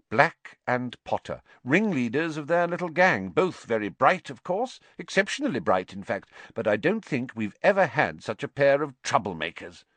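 a hint of a brisk schoolmistress. A narrator's reading. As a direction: recall two clever troublemakers as their teacher.

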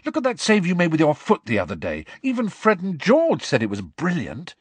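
stern, urgent pep talk. Acted. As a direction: argue a friend out of self-doubt. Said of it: "said Harry sternly".

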